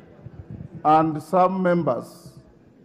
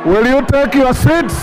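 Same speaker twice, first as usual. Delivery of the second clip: raised, high, insistent calls over the noise; calling for order; 6 semitones higher than usual. Real, not acted. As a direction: keep hammering for order above the din. Speaker calling rowdy House to order.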